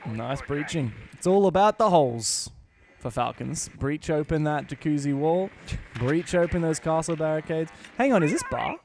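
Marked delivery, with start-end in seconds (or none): none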